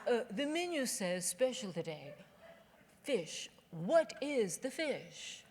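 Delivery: very slow, put-on snooty voice; slow condescending question. Snobby customer explaining the menu to the waitress, slow. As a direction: spell it out like a snob. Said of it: "And I said".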